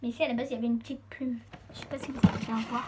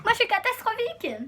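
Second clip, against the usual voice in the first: laughing; 9 semitones higher, 5 dB louder than usual. Real, not acted. Laughing at herself.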